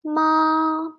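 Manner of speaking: MA high and level; first tone. Shown as a demonstration. Teacher leading learners together, ma high flat. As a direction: hold MA steady at high pitch.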